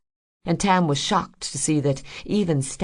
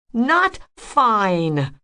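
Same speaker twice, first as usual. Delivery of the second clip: high, loud refusal; almost shouting; 8 semitones higher, 5 dB louder than usual. A narrator's reading. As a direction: cut her off hard. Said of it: "Rose was almost shouting now".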